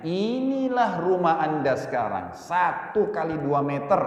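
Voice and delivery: slow, drawn-out words; solemn and very slow, opening word held long; solemn graveside pronouncement. Retold or imitated. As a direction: stretch it out to let the grave sink in. Preacher addresses man lying in grave.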